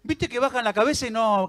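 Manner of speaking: normal talking voice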